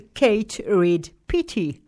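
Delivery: Kate Reid Petty, slow, in an American accent; the author's name said slowly in American English. Shown as a demonstration. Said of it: "le nom de l'auteur en américain".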